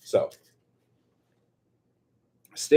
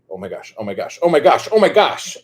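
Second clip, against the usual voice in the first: building excitement; 6 semitones higher, 9 dB louder than usual. Real, not acted. Crafter about to test the new stapler, excitement mounting. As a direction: let the excitement build with each repeat. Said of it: "I'm so excited right now".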